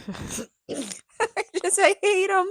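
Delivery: high-pitched words through real laughter; laughing. Real, not acted. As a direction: crack up while confessing dislike. Cracking up while mocking Jeremy.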